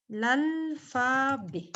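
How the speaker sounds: very slow, syllable by syllable; drawn-out dictation. Shown as a demonstration. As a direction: stretch out each syllable of "L'alphabet". Dictating the word while writing.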